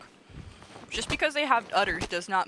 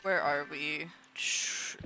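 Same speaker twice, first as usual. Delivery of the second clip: ordinary delivery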